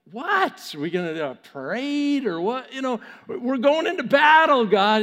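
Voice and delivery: rising, high mock objection; incredulous protest. Gideon objecting that they are going into battle, incredulous. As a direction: build to a loud, exasperated appeal.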